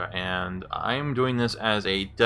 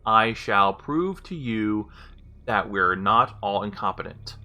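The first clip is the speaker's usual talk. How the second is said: careful dictation. Real, not acted. User retrying long command for voice recognition, careful dictation. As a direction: enunciate each chunk for a machine to process.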